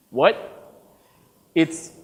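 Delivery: ordinary talk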